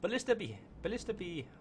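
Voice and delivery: high-pitched, badly faked Irish accent; mock Irish, pitched well up. Shown as a demonstration. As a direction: try out a hammy Irish lilt. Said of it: "I can't do a good Irish accent".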